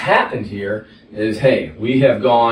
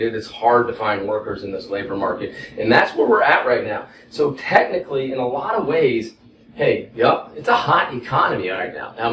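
ordinary talk

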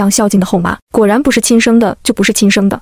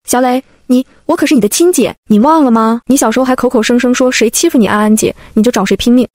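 ordinary delivery, nothing marked

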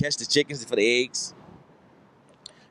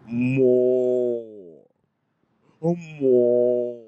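low, drawn-out "more" like a moo; mooing cow; 4 semitones lower, much slower than usual. Retold or imitated. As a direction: moo the word long and low. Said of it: "She's saying".

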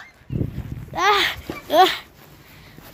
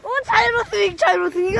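normal talking voice

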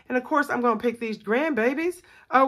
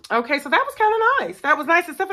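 high excited burst; 8 semitones higher, 6 dB louder, faster than usual. Real, not acted.

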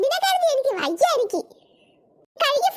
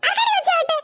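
higher-pitched, affronted repeat; indignant challenge; 6 semitones higher than usual. Acted. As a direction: demand it back, offended. Offended grandmother repeats her challenge.

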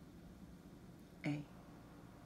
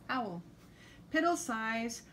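ordinary delivery

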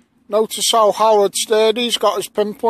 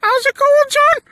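squeaky falsetto, much higher and louder; silly high voice; 4 dB louder than usual. Pretend. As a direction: greet like a cartoon unicorn. Squeaky unicorn voices exchanged.

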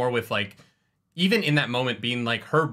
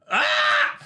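sudden, very high, sharp scream of shock; recreated scream; 4 dB louder than usual. Shown as a demonstration. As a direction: let out a startled shriek, as if caught off guard while reading. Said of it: "I think I can recreate it for you".

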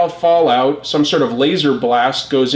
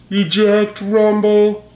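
Soundwave; 6 semitones higher, slower than usual. Acted. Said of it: "Soundwave ejects another cassette".

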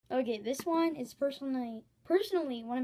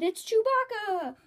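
higher, louder, slow shout; mock crowd yell; 9 semitones higher, 8 dB louder, slower than usual. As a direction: shout it like a startled moviegoer. Crowd yelling at the movies.

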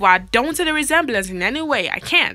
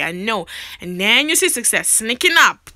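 ordinary delivery

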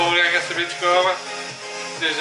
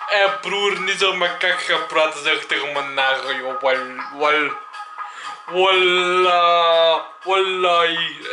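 street slang with a bro swagger; moroccan dutch street slang; slower than usual. Acted. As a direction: talk like a tough Antwerp street kid. Mock street talk to bro.

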